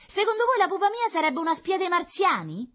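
very high, loud concierge voice; mocking indignation. Concierge defends her child to Meletti, loudly sarcastic. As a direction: challenge him with loud, high-pitched sarcasm.

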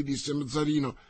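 usual speaking voice

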